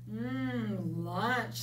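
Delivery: frog voice. Acted. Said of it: "said Frog".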